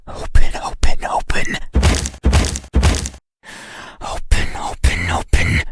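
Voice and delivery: repeated orders pushed out loud and urgent; loud, hammering commands; insistent demand. Acted. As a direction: demand it over and over, losing patience.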